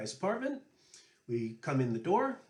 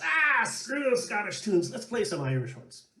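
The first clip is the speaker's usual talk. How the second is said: exasperated Johnny; 7 semitones higher, 5 dB louder than usual. Retold or imitated. Johnny giving up on Scottish tunes, exasperated. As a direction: brush it off with mock annoyance. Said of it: "And Johnny says".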